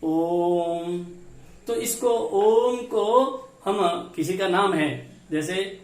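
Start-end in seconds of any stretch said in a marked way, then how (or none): 0.0-1.1 ओम with the ओ held very long, chant-like; teacher demonstrates the extra-long pluta vowel, humming the म; prolonged Om. Shown as a demonstration. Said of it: "प्लुत स्वर कैसे बोलेंगे?".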